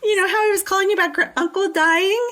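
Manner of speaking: high, brisk, loud put-on voice; mock casual caller. Mock phone call in character.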